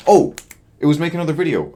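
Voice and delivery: sharp high burst of surprise; the missing goal pops into her head, loud and high; sudden remembering. Real, not acted. Vlogger suddenly recalls the last goal, startled.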